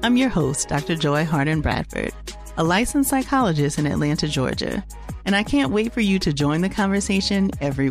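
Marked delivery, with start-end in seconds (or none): none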